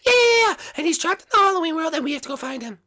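very high squeaky urgency; excitable sidekick. Acted. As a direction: plead for a rescue in a tiny voice.

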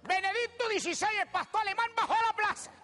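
high, emphatic mock pride; hammed-up triumphant retelling; acted proud boast. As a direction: boast about it to a doubter. Boasting of meeting the Pope.

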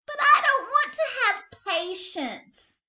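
high, loud whiny protest; bratty little bunny protesting; protesting child. A narrator's reading. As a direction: whine it back at mom, half laughing. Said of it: "Betty Bunny protested".

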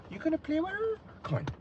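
high, soft cooing question; high, soft coo to the pup. Real, not acted. Baby talk to the dog.

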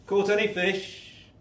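a stranger calls from the shore to the fishermen; calling out. A narrator's reading. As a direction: shout out to the boat. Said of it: "And he shouts".

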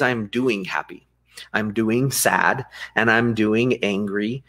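slow, each "I'm doing" phrase laid out deliberately; stress on doing. Shown as a demonstration. Coach showing a podcast host the better phrasing, slow and deliberate. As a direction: model the phrasing "I'm doing happy", "I'm doing sad", "I'm doing angry" slowly and firmly. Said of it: "the better way to say it is".

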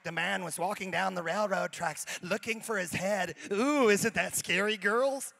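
light mock-spooky storyteller voice, drawn out on the ooh; a hint of a youth leader hamming up a ghost story. Youth leader teasing the girls with a mock-spooky ghost story. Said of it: "she was telling ghost stories".

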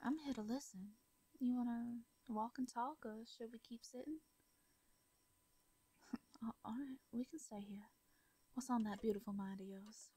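plain reading voice

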